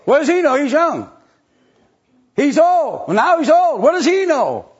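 high, loud, sneering voice mocking the critics; mocking scoffer. Preacher mimics critics dismissing the old in a high, loud sneer. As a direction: whine it out like a snide gossip. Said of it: "they say".

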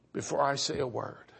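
ordinary delivery

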